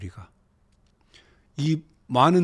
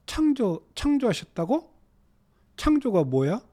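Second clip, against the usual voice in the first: a hint of a curious child; higher, wondering questions; childlike questioning; 7 semitones higher than usual. Shown as a demonstration. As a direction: ask lightly like a child learning words. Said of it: "진짜 어린애, 말 배우는 애가".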